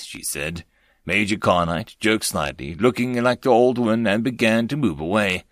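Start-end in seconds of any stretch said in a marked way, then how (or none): none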